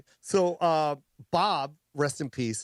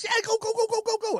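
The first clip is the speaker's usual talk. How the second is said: much higher, manic excited Robin voice; Robin Williams impression; much faster than usual. Comic voicing Robin Williams, surprised he's not on, high and manic. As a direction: squeal it like a giddy Robin Williams. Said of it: "He goes".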